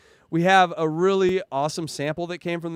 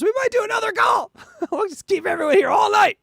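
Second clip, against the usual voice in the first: mock shout; 13 semitones higher, 5 dB louder than usual. Real, not acted. Joking about more calls.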